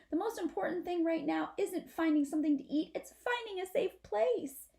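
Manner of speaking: a scared little possum reasoning it out; little possum Apple Blossom. Acted. Apple Blossom thinks aloud.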